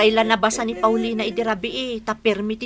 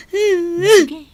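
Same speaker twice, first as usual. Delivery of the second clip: high crying voice; 7 semitones higher, 4 dB louder than usual. Acted. Crying woman answers friend.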